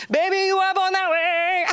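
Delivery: put-on high, sassy "baby" line; sassy reassurance. Comic quoting herself reassuring the man, high and sassy. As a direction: camp it up, pitched high.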